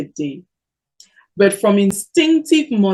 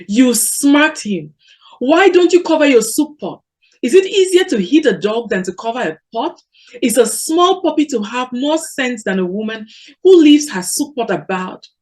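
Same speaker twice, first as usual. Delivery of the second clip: higher, louder, outraged accusation; a co-wife shouting over a puppy; furious scolding; 5 semitones higher, 5 dB louder than usual. A narrator's reading. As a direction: hurl the questions at her rival. Udenko rails at Mboye.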